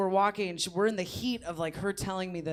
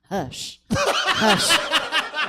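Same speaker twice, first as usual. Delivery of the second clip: mimicking; 7 semitones higher, 7 dB louder than usual. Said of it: "she keeps going".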